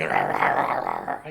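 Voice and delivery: mock grumbling under the breath. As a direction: growl the nonsense syllables low.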